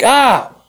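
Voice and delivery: high-pitched "ow"; retold yelp of pain. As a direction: imitate a startled cry of pain. Retells being grabbed painfully.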